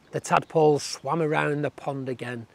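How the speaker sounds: plain reading voice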